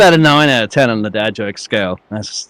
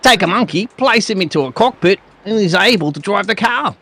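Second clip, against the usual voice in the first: high-pitched, broad Aussie read; exaggerated Australian for demonstration; Australian accent; 9 semitones higher than usual. Shown as a demonstration. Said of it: "you're gonna do it like that".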